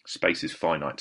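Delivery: ordinary talk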